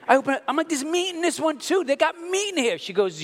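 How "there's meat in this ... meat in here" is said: high, startled realisation; shocked discovery. Retold or imitated. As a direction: gasp it out in disbelief. Discovering meat in ravioli.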